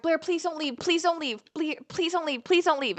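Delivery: high-pitched, repetitive pleading imitation; mock begging. Girls begging her as she packs, mock pleading.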